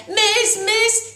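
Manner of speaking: high, loud schoolboy call for the teacher. Acted. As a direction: wave for attention and call out. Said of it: "he called out".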